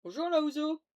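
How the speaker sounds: loud mocking hello; shouted greeting. Real, not acted. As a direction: call it out loud. Loud taunting greeting in game.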